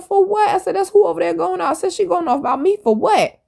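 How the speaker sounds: high-pitched indignant questioning; retold incredulity. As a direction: act out disbelief at being blamed. Retold indignant reaction to accusation.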